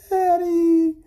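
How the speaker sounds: put-on high, loud child's wail; crying little girl. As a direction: wail for parents like a small girl. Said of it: "he heard this crying sound".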